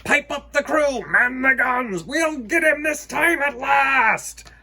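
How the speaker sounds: high, loud barked commands; rally the crew to the guns; pirate captain's orders. Acted. Hook orders his crew to fire, loud.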